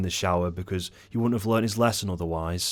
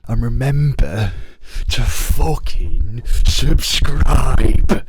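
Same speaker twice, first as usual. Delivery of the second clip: much louder, higher, drawn-out yell; mock shout; 4 semitones higher, 13 dB louder, much slower than usual. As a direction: bellow it slowly like a hammed-up outro. Mock-aggressive outro shout.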